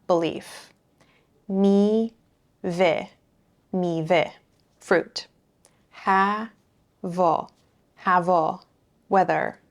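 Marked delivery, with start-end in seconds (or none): 1.5-4.3 "Mi ve" split into syllables, then joined. Shown as a demonstration. Teacher splitting a word into syllables for students, slowly. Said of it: "it also starts a syllable". 5.9-8.6 "Ha va" split into syllables, then joined. Shown as a demonstration. Teacher splitting a word into syllables for students, slowly. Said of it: "it also starts a syllable".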